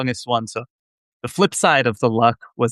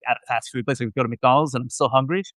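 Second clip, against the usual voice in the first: usual speaking voice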